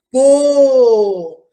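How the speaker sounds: bo said loud and isolated; stressed syllable bo; the sound bo. Shown as a demonstration. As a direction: punch out bo as a single syllable. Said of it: "sonzinho do".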